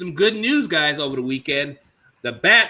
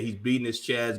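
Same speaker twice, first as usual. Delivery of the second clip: ordinary delivery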